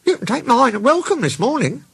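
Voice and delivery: high-pitched surprised engine; high storybook voice, taken aback. A narrator's reading. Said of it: "Percy looked surprised".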